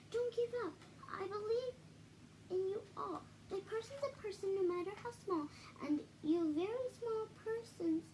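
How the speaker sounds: high, urgent, pleading elephant voice; pleading call. Acted. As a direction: beg them not to give up. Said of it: "he managed to call to the mayor".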